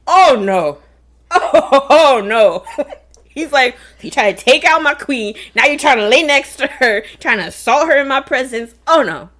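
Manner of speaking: loud outraged king yelling; furious king. Retold or imitated. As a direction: roar at the man on the queen's bed.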